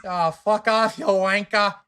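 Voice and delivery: hammed-up British insult, pitched high; British accent. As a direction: swear loudly in a put-on British accent. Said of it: "All right, British accents, go".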